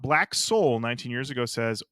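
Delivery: ordinary talk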